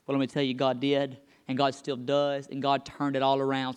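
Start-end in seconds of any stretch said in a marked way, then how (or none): none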